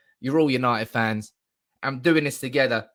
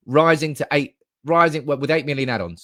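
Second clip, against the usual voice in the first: ordinary talk, nothing marked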